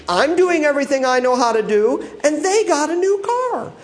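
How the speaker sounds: high, whining complaint to God, climbing; hammed-up jealous griping; envious whine. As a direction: plead it's unfair like a sulking child.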